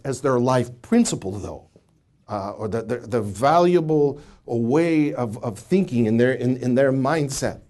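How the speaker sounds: normal talking voice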